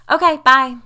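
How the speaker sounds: put-on high, loud little-girl farewell; eager quick goodbye; chirpy child. A narrator's reading. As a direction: call it out while dashing off. Child dashing off outside.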